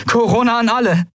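high, urgent radio call. A narrator's reading. Pilot hailing everyone on the recorder, urgent call. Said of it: "rief sie in den Kubusaufzeichner".

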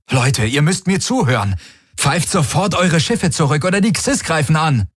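lightly voiced urgent messenger; urgent envoy. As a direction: urge them to listen now. Garlin's urgent comm message.

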